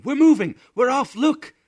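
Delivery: high, loud joyful screams; children screaming. A narrator's reading. Children in hammocks scream with excitement. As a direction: shriek with delight as the rocket lifts.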